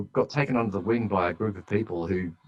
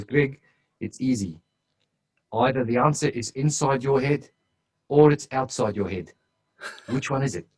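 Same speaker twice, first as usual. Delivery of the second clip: put-on a louder, raised South African accent; 6 semitones higher, 5 dB louder than usual. Consultant telling Greg where answers lie, in a South African accent. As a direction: give the South African's blunt riddle with weight. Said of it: "in his really strong South African accent".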